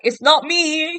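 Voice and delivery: high-pitched, mock-panicked wail; panicked protest. Grover blamed as the shoes fly off, high panicked protest.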